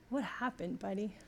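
put-on soft, tender question to a child; gentle mother. Mother softly asks her upset son what happened, tender and quiet. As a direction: hush down and ask him kindly.

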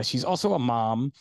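ordinary delivery

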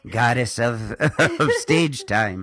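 laughing mock grand goddess; hammed-up self-important proclamation; know-it-all voice. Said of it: "my I know everything voice".

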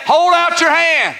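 high, drawn-out preacher's command. Acted. Preacher voicing Jesus' command to the man, commanding.

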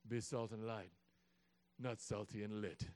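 mock-solemn low verse reading; low mock scripture. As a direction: intone it like holy writ. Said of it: "as the Lord saith".

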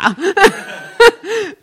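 high, loud burst of laughter; real hearty laugh. Real, not acted. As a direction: crack up at your own story. Laughing at own anecdote.

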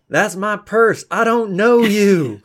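high-pitched indignant yell, drawing out the end; mock yell. Player voicing Isolde resisting the urge to yell, mock yell. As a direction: yell it high like an outraged stranger. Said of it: "just to yell".

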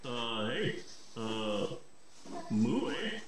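low, soft mock wolf voice; a lightly voiced Canadian wolf, low. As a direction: murmur it like a shy Canadian wolf.

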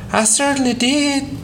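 put-on high, stubborn child's voice; a little boy insisting he's right; insistent little boy. A narrator's reading. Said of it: "Doodle reiterated".

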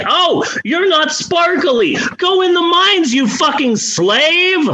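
mock scornful dismissal. Ruling class banishing the kid to the mines, mock scornful. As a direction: imitate a snooty ruler passing sentence. Acts out cruel rejection of powerless kid.

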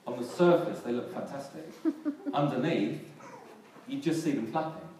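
loud, punchy preaching; forceful preacher emphasis. Acted. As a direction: drive the contrast home from the pulpit. Preacher building to a point.

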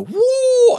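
high, loud "woo"; excited whoop. Retold or imitated. Pioneer husband who loves change, excited whoop. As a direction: whoop like a thrill-seeker. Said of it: "And I'm like the".

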